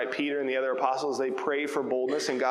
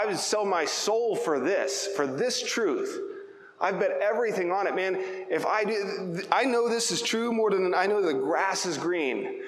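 higher-pitched, insistent declaration; emphatic conviction; 5 semitones higher than usual. Shown as a demonstration. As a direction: preach it like you've staked everything on it. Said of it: "speaking emphatically about it".

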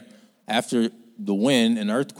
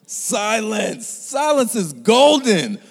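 high, slow, ringing proclamation; preaching proclamation; 11 semitones higher, 6 dB louder, much slower than usual. Acted. Preacher proclaiming silence to the congregation.

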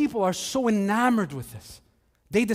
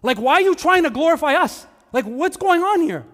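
higher, louder pleading shout; shouting apostles; 7 semitones higher, 6 dB louder than usual. Acted. Paul and Barnabas shouting at the worshipping crowd, higher and louder. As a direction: cry out to stop the crowd. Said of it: "rushed into the crowd shouting".

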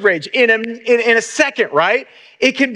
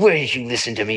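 exasperated; 11 semitones lower, 4 dB quieter than usual. Retold or imitated. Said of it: "and I'm like".

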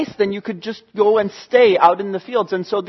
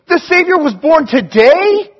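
high rising question; can't believe it, asking; questioning disbelief; 6 semitones higher, 4 dB louder than usual. Acted. Shepherds hearing the news, astonished question. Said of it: "the shepherds are going".

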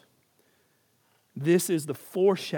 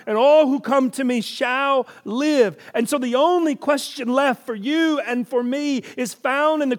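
louder, higher pulpit delivery; full-force preaching; 10 semitones higher, 9 dB louder than usual. Acted. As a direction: press the truth on the congregation.

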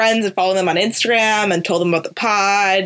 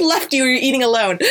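higher voice, shaking with laughter; laughing while talking; 4 semitones higher than usual. Real, not acted. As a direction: finish the thought through a laugh. Laughing about her awkward dinner.

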